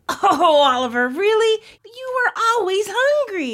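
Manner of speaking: loud, high exasperated sister; high-pitched, loud exclamation; exclaiming. A narrator's reading. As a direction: burst out in mock exasperation. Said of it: "exclaimed Olivia".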